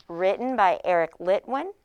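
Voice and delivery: normal talking voice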